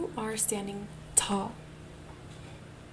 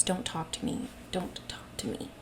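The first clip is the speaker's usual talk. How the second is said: withdrawn mutter; 2 semitones lower, 4 dB quieter than usual. Pretend. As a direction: shrink away as if closed off, half under the breath. Voicing a closed, slouching person.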